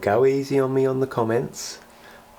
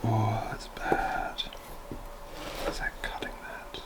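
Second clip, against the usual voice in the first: worried whisper; 9 dB quieter than usual. Real, not acted. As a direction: whisper it, afraid the wire is cut. Worried muttering over the wiring.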